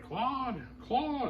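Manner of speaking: high, carrying call of Claude's name; calling for the cat. Retold or imitated. Mrs Mack calling up into the attic for her cat, high and searching. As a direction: sing out the cat's name up high like a worried owner. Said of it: "and she's like".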